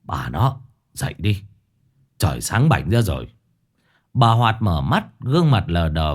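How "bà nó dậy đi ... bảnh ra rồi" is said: gruff, lower, calling her to get up; husband waking wife. Acted. Old husband calls his sleeping wife awake, lower.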